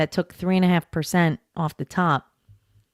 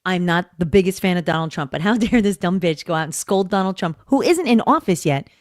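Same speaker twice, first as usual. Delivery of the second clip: normal talking voice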